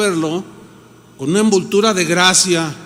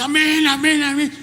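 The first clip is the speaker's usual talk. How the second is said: high mock chorus of amens; congregation amen; 7 semitones higher than usual. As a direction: mimic the crowd cheering on. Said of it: "Y la gente". (congregación respondiendo amén, voz aguda burlona)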